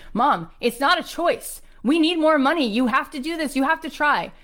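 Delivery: high, urgent acted daughter; imitating a daughter who won't let go; insistent pleading. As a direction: keep pressing, pitched well up. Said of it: "her daughter didn't let go. She kept saying".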